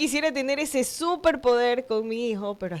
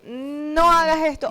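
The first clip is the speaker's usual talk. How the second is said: stern no; 4 semitones higher, 4 dB louder, slower than usual. Retold or imitated.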